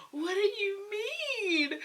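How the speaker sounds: very high, slow and stretched out; drawn-out high-pitched incredulous question; stunned disbelief. Acted. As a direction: squeal it slowly in mock shock. Acts out stunned reaction to news.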